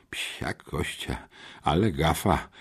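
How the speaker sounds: low, hushed, aghast; choked out. A narrator's reading. Boy realizing his blunder with a teacher, choking it out. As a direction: force the words out under your breath. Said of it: "wykrztusił".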